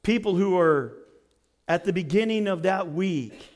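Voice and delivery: ordinary talk, nothing marked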